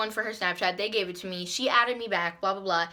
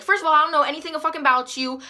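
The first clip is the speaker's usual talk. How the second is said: fast, raised retold rant; going off; 6 semitones higher, 5 dB louder, faster than usual. Girl going off on a classmate at lunch, fast rant. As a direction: rattle it off higher and louder. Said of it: "I'm going off on her".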